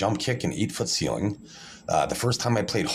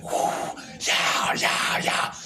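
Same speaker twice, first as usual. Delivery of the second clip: retold excited whoops; super excited; much slower than usual. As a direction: cheer hoarsely, reliving the excitement. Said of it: "I was super excited".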